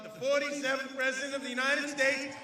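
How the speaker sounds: normal talking voice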